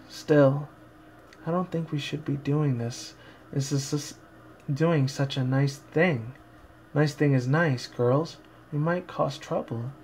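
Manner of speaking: soft, slow, timid pony voice; shy timid Fluttershy. Acted. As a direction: barely dare to speak, shrinking away. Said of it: "Fluttershy tried to bring her shyness and timidness".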